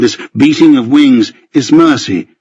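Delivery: ordinary delivery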